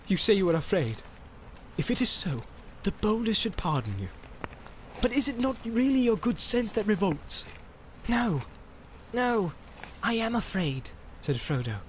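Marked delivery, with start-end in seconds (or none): 0.1-7.5 higher-pitched, coaxing warrior; Boromir, persuasive. Acted. 8.1-10.9 high, softer hobbit voice; small, high voice as Frodo; Frodo, afraid. Acted.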